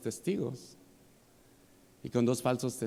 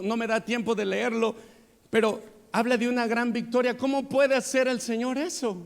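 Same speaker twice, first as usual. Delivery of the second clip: raised, louder preaching, pressing the questions hard; fiery pulpit delivery, higher and louder; preaching at full force; 10 semitones higher, 3 dB louder than usual. Acted. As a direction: build fervor as you question the congregation. Preacher rising in fervor.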